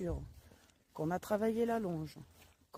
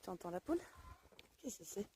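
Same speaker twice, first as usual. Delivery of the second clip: talking to a dog; 14 dB quieter, faster than usual. Real, not acted. Softly asking the puppy.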